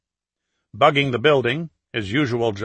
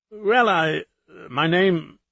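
put-on faltering London accent, higher pitch; 6 semitones higher, much slower than usual. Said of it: "in his best London accent".

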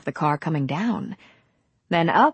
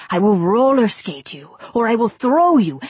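loud, ardent insistence, rising higher; intense protest; 3 semitones higher, 5 dB louder than usual. A narrator's reading. Young Latin suitor to Nicole, protesting intensely. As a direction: outbid a rival with passionate promises. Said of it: "Marmora protested intensely".

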